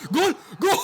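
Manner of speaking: very high, loud goal shout; goal scream. Real, not acted. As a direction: scream it the moment it goes in. Goal celebration.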